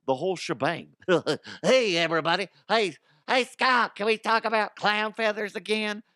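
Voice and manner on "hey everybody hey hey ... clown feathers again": high, loud goofy clown greeting; hammy circus clown, squeaky and bouncy. Acted. Host greets Scott in a silly high clown voice. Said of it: "I'm trying to find my clown voice".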